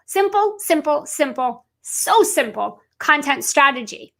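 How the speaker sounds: simple, simple, simple, each word drawn out slowly and leaned on hard; stress on simple. Shown as a demonstration. Coach drilling simple into her audience, slow heavy stress on simple. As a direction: hammer the word simple, slowing right down. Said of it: "Notice my emphasis, my, that I'm emphasizing on simple".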